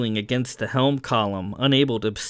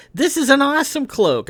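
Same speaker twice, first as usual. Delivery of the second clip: high, gushing enthusiasm; thrilled and admiring; excited praise; 4 dB louder than usual. Acted. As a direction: rave about it, pitch way up. Character praises the friend's work.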